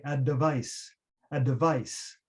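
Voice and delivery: a tiny vowel between d and v, "a device"; vowel inserted. Shown as a demonstration. Teacher showing learners how to split the dv cluster. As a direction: break the cluster: "a device" with a schwa after d. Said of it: "So for advice, we might say".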